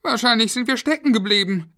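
put-on high, loud child voice; squeaky, anxious kid's chatter; worried children. A narrator's reading. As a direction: wonder aloud like a kid stuck in a lift. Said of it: "glaubte Florian".

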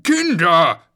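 put-on loud, high shout for the children; sharp parental call. A narrator's reading. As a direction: yell to summon the kids. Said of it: "rief Schnuffel".

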